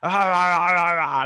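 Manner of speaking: loud, high mock-angry "ah" sputters; angry sputtering. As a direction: sputter like you're too mad for words. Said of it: "When I get angry".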